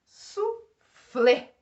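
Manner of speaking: "Suflê" high and loud, split in two; "Suflê" as su, pause, FLÊ; stress on last syllable. Shown as a demonstration. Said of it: "Última sílaba mais forte".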